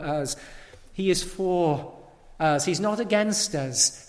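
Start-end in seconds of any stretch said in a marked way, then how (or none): none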